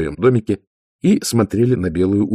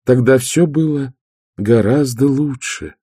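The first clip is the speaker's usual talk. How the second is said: pitched up, wistful, slowing to the end; dreamy drawl; 6 semitones higher, 4 dB louder than usual. A narrator's reading. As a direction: sigh over the good old days. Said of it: "мечтательно протянула средняя сестра".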